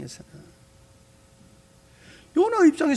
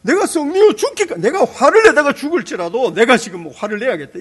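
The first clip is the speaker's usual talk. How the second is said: loud, higher, stubborn complaint; mock angry Jonah; 7 semitones higher, 9 dB louder than usual. Pastor acts out stubborn Jonah defying God, loudly angry. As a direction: play Jonah sulking in a loud huff. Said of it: "이 고약한 요나는".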